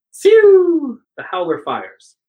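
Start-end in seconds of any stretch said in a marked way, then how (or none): 0.1-1.0 high, loud "pew" of a ray gun; imitated laser shot. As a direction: mimic a beam weapon firing. Imitating the howler's beam firing.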